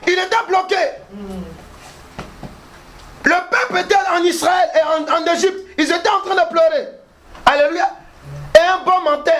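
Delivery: high, declaimed preacher's storytelling; full-force preaching. Acted. Preacher telling of Israel weeping in Egypt, full-force preaching. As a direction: proclaim the story in high, separated phrases.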